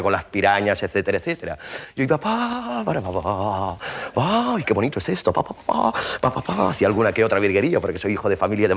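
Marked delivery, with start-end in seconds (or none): 2.0-6.7 light rhythmic paddle beats; paddling sounds. Retold or imitated. Gliding down the Amazon, playful paddle beats. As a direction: tap out the strokes of a canoe.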